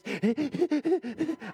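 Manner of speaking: rapid high gasps for air; frantic swimmer's breathing. Shown as a demonstration. Poor swimmer shows how they breathe, frantic gasping. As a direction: demonstrate panicky puffing while swimming. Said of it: "I breathe like this".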